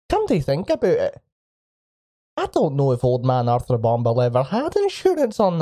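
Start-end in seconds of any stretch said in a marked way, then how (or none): none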